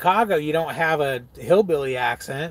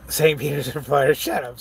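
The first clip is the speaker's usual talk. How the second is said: normal talking voice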